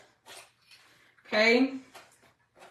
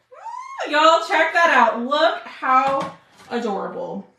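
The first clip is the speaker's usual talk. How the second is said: delighted reveal; 3 semitones higher, 5 dB louder, slower than usual. Real, not acted. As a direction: show it off with real delight. Delighted reveal of finished craft.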